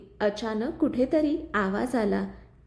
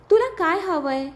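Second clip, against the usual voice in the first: louder, higher cry of alarm; shouting; 6 semitones higher, 4 dB louder than usual. A narrator's reading. Anvi shouting at the voice in the speaker. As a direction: shout it out in fright. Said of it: "ती ओरडली".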